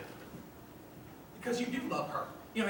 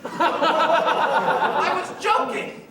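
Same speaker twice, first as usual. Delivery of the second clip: nervous laugh; 3 semitones higher, 6 dB louder, much slower than usual. Pretend. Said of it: "do you say".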